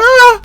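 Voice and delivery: short, high, loud scream; startled scream. Real, not acted. Player scared of what is behind, startled scream. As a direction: yelp in sudden fright.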